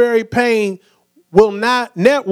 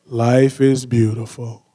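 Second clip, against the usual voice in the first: low, slow, droopy donkey voice; mopey cartoon donkey impression; Eeyore voice; 10 semitones lower, 3 dB quieter, slower than usual. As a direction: say happy words in Eeyore's dead gloom. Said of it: "Eeyore, he's always just like".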